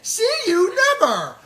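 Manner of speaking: very high, loud squeaky sendoff; shrill it out like a cartoon; squeaky mock voice. Husband mimicking the voice on the show, very high. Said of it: "Can I tell you what that voice sounded like?".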